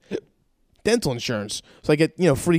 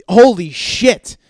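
loud, slow, high-pitched shock; upset outburst; 5 semitones higher, 8 dB louder, much slower than usual. Retold or imitated. Dentist cutting off the patient's explanation, appalled. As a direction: cut in appalled, stretching it out. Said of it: "they'd get upset with me".

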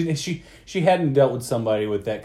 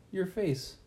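softer, calm, dry retort; calm comeback to a rude customer; deadpan; 5 semitones higher, 10 dB quieter than usual. Retold or imitated. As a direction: say it flat and quiet to sting. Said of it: "And you go".

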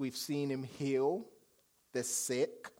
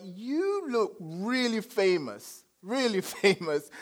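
laughing and awed; 7 semitones higher, 7 dB louder than usual. Retold or imitated. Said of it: "And then I'm like".

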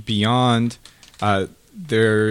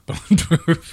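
laughing; 8 semitones higher, 4 dB louder than usual. Real, not acted. Laughing repeat of joke.

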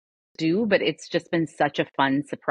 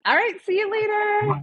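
sing-song goodbye. Real, not acted.

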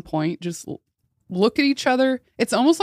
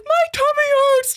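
very high, louder mock wail; mom's whining excuse; 5 dB louder than usual. Daughter mimicking mom dodging tickle time, high whine. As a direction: imitate a mom whining to get out of it. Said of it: "she's like".

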